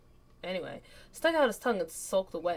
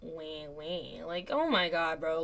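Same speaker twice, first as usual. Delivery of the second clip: ordinary delivery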